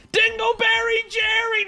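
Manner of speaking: high, stretched-out holler falling away at the end; drawn-out shout. Real, not acted. Streamer breaks from the story to holler a name, drawn-out shout. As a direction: holler it high and slow, then let it drop.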